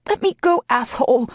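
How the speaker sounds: high, loud angry yell; furious yell. A narrator's reading. Heroine yelling at her lover to let go. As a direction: yell it while struggling free. Struggling against his hold.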